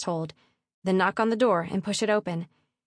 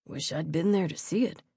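lower, lightly voiced guard; wistful guard; 3 semitones lower than usual. A narrator's reading. Guard replying to his fellow, a touch wistful. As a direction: mutual grumble between guards, lightly acted.

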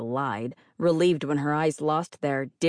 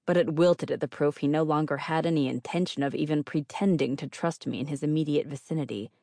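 plain reading voice

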